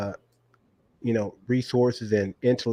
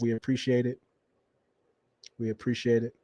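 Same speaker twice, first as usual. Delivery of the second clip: normal talking voice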